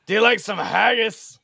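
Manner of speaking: loud, high-pitched Scottish impression; mock Scottish accent. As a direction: offer it in a playful Scottish accent. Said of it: "No, bot, that was Scottish".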